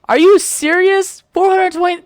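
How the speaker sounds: loud, high incredulous outburst; stretched-out, high-pitched indignation; outraged disbelief. Real, not acted. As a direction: blurt it out high and loud, appalled. Outraged reaction to the quote.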